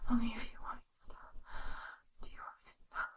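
fast soft whisper. Real, not acted. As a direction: drop to a quick whisper. Repeat in whisper.

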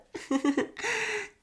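loud, high burst of giggling laughter; delighted laugh. Acted. Delighted burst at his climax.

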